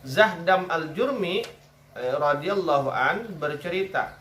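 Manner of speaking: slow, formal recitation; hadith reading. Acted. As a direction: read the narrator's name deliberately. Reads hadith aloud.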